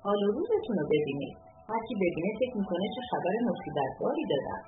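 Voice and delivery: ordinary delivery, nothing marked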